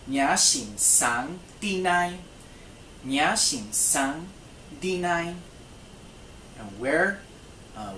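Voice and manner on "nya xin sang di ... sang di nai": very slow, careful model phrase; slow language-lesson demo. Shown as a demonstration. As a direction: break it apart slowly for learners. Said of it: "Where's your husband?".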